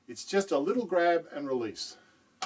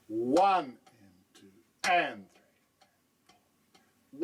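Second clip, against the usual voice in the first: drawn-out count, accents leaning louder; very slow demo count; 4 dB louder, much slower than usual. Shown as a demonstration. Teacher slowly counting exercise three for the student. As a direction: stretch each count out slowly. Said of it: "Exercise three shifts the second accent".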